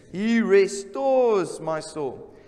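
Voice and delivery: slow, loud, solemn proclamation; slow emphatic scripture. Acted. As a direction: stretch out every word of the line.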